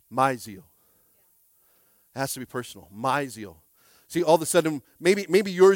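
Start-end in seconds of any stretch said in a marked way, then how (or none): 0.1-0.6 "my" hit hard and falling; "My zeal" with weight on "my"; stress on my. Shown as a demonstration. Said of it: "emphasis on my". 2.9-3.6 "my" hit hard and falling; "My zeal" with weight on "my"; stress on my. Shown as a demonstration. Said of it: "repeat emphasis".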